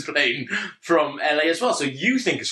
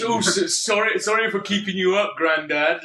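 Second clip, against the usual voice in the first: high-pitched mocking apology; mock an apology in a put-on high voice; taking the piss; 7 semitones higher than usual. Passengers teasing the man they kept awake, mocking. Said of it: "just taking the piss".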